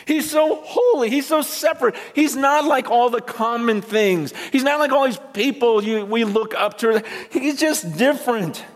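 overwhelmed with awe, pushing it up high; an angel before the throne, high and awed; awestruck angel. Acted. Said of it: "He goes".

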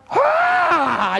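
very high, loud cry of delight; joyful shout. Real, not acted. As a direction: let out a real burst of joy. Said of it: "it just makes me come alive".

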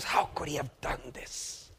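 hushed, hurt disbelief; almost whisper it, wounded. Acted. Brother hearing what happened to his sister, hushed and hurt. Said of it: "he is cut".

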